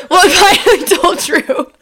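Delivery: can't keep a straight face; giggling vlog line. Acted. Danny telling his fans the news, giggling.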